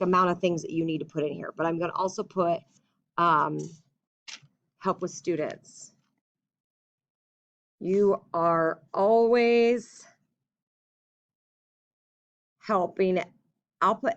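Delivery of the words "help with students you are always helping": slow, drawn-out dictation. Shown as a demonstration. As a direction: spell it out as you write. Teacher dictates letter aloud.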